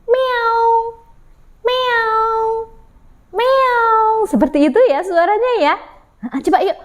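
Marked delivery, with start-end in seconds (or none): 0.1-4.2 high, long, even meows; cat meow. Shown as a demonstration. Storyteller shows children the cat sound, high meow. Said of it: "menirukan suara kucing".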